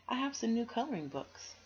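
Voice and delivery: high, bright friendly invite; cheerful little girl. Acted. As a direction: invite her sweetly.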